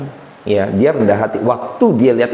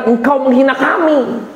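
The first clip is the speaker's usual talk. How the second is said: offended protest; 10 semitones higher than usual. Retold or imitated. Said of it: "merasa dihina".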